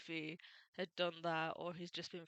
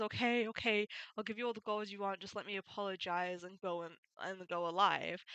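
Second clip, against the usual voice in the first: ordinary talk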